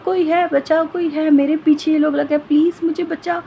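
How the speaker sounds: high, pleading calls for rescue; scared cry for help. Acted. Girl chased by goons calls out, frightened. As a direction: beg for help while running.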